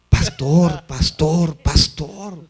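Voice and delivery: put-on drunkard calling out to the pastor; booming, repeated call; loud hailing. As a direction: call it out very loudly, word by word.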